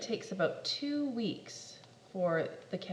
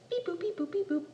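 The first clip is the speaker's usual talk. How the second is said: dialing beeps; 7 semitones higher, faster than usual. Acted. Phone dialing noise.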